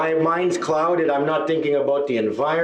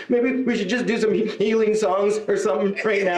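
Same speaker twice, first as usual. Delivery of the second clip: higher, tearful plea; mock crying; 6 semitones higher than usual. Comedian begs organisers for healing songs, mock weepy. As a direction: plead as if crying, hammed up. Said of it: "I was in the back corner crying".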